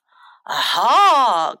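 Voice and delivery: loud, drawn-out villainous "aha"; mean dragon cry. A narrator's reading. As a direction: gloat like a storybook dragon. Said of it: "cried the mean dragon".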